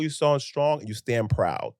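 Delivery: usual speaking voice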